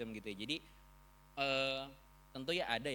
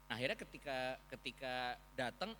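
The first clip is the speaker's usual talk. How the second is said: ordinary delivery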